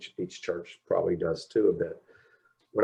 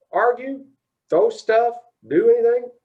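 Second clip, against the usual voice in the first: "Argue?" much higher and louder, disbelieving; incredulous repeat; 14 semitones higher, 6 dB louder than usual. Retold or imitated. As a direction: fire back "Argue?" high and loud in disbelief.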